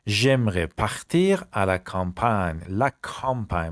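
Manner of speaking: campagne said slowly as kampan, not kampain; careful pronunciation. Shown as a demonstration. Teacher warning students, careful slow model. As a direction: stress the right sound of campagne. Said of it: "تلفظش کمپاین نگی".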